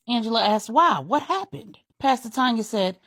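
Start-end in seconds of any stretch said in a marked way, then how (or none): 0.7-1.8 alarmed question. A narrator's reading. Angela alarmed by break-in news.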